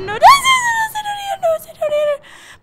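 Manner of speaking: very high frantic shrieks; shrieking panic. Real, not acted. Gamer lost while chased, shrieking very high. Still fleeing in the game.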